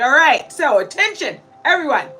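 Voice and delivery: mock announcement. Telling her followers how she started her day, a loud commanding call. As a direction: raise the voice and summon the room to attention. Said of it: "I was like".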